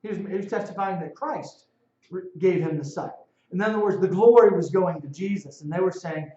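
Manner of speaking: normal talking voice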